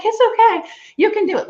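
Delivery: high, loud mock-cheerful reassurance; imitating Robin reassuring. Friend Robin cheering up nervous Jen, high and loud. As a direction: play the laughing friend cheering her on.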